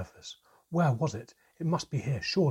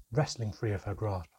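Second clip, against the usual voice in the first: ordinary delivery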